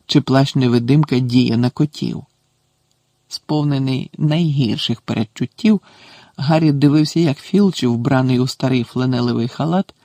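usual speaking voice

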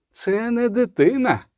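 high, cold declaration; inhuman voice. A narrator's reading. Inhuman voice warning the sorceress off the child, cold. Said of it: "насміхався металевий нелюдський голос".

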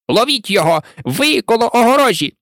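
high, urgent calling out; Rabbit's shouted order. A narrator's reading. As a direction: order the others to catch him. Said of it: "а потім голос кролика". (наказовий вигук Кролика)

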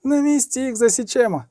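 high-pitched youthful threat; hoarse young knight. A narrator's reading. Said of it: "сипів молодим голосом другий".

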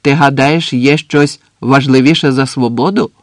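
loud, truculent question; cocky challenge. A narrator's reading. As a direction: ask it spoiling for an argument. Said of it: "запитав він задирикувато".

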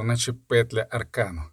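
plain reading voice

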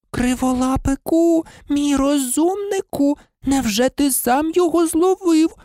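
high, slow sugary coo to a pet; cooing baby talk. A narrator's reading. Hermione coos lovingly at her cat. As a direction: lisp and coo like praising a kitten. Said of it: "засюсюкала Герміона".

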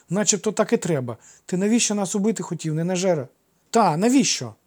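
put-on high, loud boyish challenge; insistent childish questioning; accusing child. A narrator's reading. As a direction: demand an answer like a cross little boy. Boys confront their would-be attacker.

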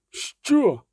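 high-pitched, disbelieving outburst; shocked what. A narrator's reading. Milva hearing the villagers' demand, shocked. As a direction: gasp out the question in outrage.

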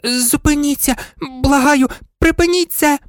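very high, pleading squeak; odd little-dog voice. A narrator's reading. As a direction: plead as a tiny toy dog. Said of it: "химерний курдупликів голос". (химерний тоненький благальний голосок)

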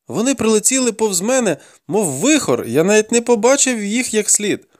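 high-pitched denial; pleading captive boy. A narrator's reading. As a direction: protest in a high boy's voice. Captive boy explaining himself under threat.

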